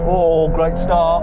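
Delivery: thrilled and drawn out, pitched high; slow, high exclamation of excitement; excited reaction. Real, not acted. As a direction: stretch it out in real delight at the launch. Reacting during the race launch.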